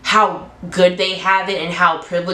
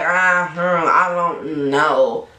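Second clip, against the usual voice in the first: doubtful; 2 semitones lower, much slower than usual. Retold or imitated. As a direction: drag it out, unsure about her. Retold doubt about the sister.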